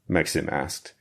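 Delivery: ordinary delivery, nothing marked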